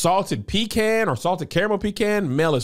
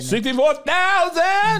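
slow, squeaky high echo; high drawn echo; 3 dB louder, slower than usual. Acted. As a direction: stretch it out up high. Echoing high.

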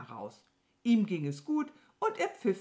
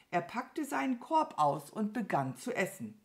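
plain reading voice

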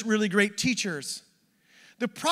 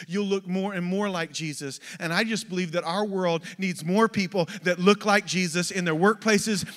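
usual speaking voice

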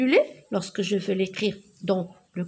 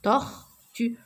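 "tortue" in two detached beats; "tortue" slowly split for counting; syllable by syllable. Shown as a demonstration. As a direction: say "tortue" as tor, pause, tue. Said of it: "Deux syllabes".

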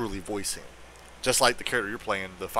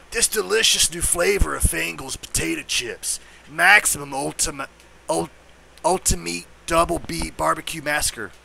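werewolf; 5 semitones higher, 4 dB louder than usual. Acted. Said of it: "I got the werewolf's voice down pat".

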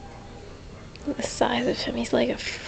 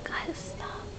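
soft running whisper; 2 semitones lower, 12 dB quieter than usual. Real, not acted. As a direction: whisper the commentary. Hushed running commentary on pets.

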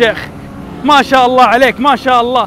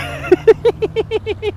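high giggle; 12 semitones higher, 5 dB quieter than usual. Real, not acted. Laughing after teasing.

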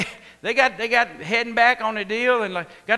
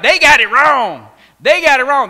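much louder, insistent; emphatic protest; 3 semitones higher, 11 dB louder than usual. Retold or imitated. Jesus protesting the Pharisees got it wrong, loud. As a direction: hammer it twice.

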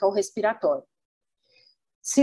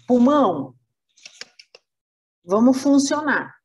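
louder, bossy summons to the lung; calling out an order; 3 semitones higher, 4 dB louder than usual. Acted. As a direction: call the lung to work like a boss. Brain orders lung to work.

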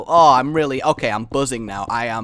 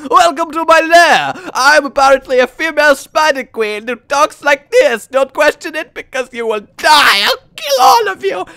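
Transylvanian Spider Queen; 14 semitones higher, 12 dB louder than usual. Acted. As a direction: menace them in a hammed-up Transylvanian accent. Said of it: "really get a Transylvanian".

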